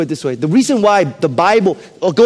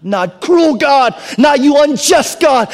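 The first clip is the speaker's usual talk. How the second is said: slow, loud, high protest; emphatic preaching cry; 9 semitones higher, 4 dB louder, slower than usual. Acted. Preacher crying out to God in protest, slow and loud. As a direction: lift it high and slow, pleading.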